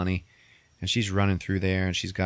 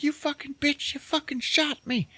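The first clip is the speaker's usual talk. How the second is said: high, strained mock outrage; outraged. Billy Bob to Kathy Bates after being shot, high mock outrage. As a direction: squeal it like a shot man indignant. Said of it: "He's like".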